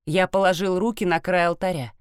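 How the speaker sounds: usual speaking voice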